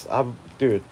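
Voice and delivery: ordinary talk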